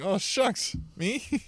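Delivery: high, soft, bashful oh shucks; a high, soft, humble little voice; mock bashful. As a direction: play it coy and surprised.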